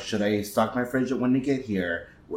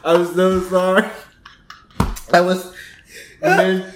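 laughing apology; 7 semitones higher, 4 dB louder than usual. Real, not acted. Apologizing while laughing after spit.

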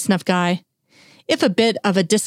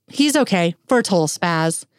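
ordinary delivery, nothing marked

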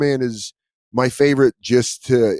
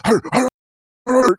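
much higher barking hur sounds; 14 semitones higher, 3 dB louder than usual. Retold or imitated. Terrier alerting dad, high barking hurs. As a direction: bark it out in a high dog voice. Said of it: "He's just barking".

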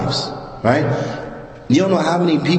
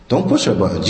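low, stress on PUSH; 9 semitones lower than usual. Shown as a demonstration.

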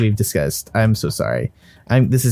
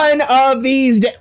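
mock echo; 12 semitones higher, slower than usual.

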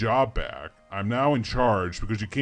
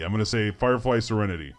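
usual speaking voice

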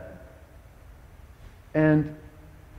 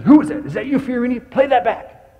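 very high, loud taunting coach; mock the player like a sneering coach; 9 semitones higher, 5 dB louder than usual. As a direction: coach taunting Fiorini over game film, shrill and loud. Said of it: "And Molman said".

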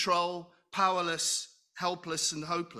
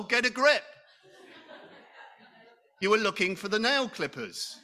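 higher and louder, laughing scold; mock stern; 5 semitones higher, 4 dB louder than usual. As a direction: snap yourself out of it, in jest. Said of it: "And I say to my soul".

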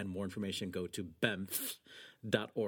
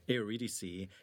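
ordinary talk

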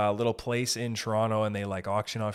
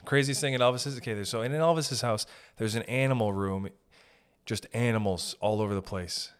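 ordinary talk

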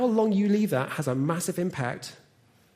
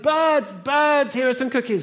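high, loud call to the birds; shouting; 8 semitones higher, 6 dB louder, slower than usual. A narrator's reading. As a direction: call out loudly, pitched right up. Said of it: "and then he shouted".

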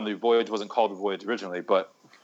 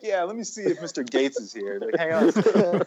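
receptionist voice; 7 semitones higher than usual. Retold or imitated. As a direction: brush the caller off politely as a front desk. Acting out a receptionist answering.

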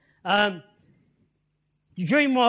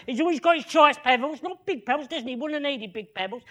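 ordinary talk

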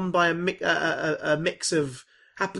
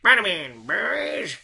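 gruff editor bark, louder; barking newspaper editor; 5 semitones higher, 4 dB louder, much slower than usual. Retold or imitated. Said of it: "Get me pictures".

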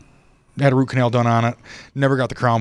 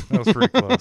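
"That was pretty close" said much higher, in a put-on voice with a rising end; mock crowd aw; 9 semitones higher than usual. Shown as a demonstration. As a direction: swoop "close" up like a cooing crowd. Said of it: "more of a deep sigh than a".

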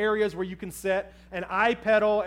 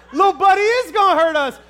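loud, high frightened retort; exasperated shout; 8 semitones higher, 11 dB louder than usual. Retold or imitated. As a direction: snap back at your wife, scared. Retorting in fear.

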